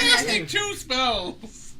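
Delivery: high, slow, drawn-out protest; excited protest. Real, not acted. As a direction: stretch it out in mock outrage. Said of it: "protesting the exclusion".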